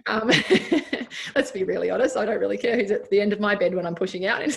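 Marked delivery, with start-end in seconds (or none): 0.4-1.3 breathy burst of high laughter; high unvoiced laugh. Real, not acted. She cracks up at her own joke, laughing.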